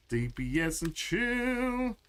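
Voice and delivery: "D P S and chill" drawn out slow and high like a show title; announcer voice. Shown as a demonstration. Hammy title drop for the raiders.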